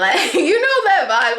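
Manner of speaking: laughing. Real, not acted. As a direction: let a laugh lift the line. Amused at own quitting attitude.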